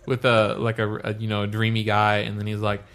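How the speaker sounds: usual speaking voice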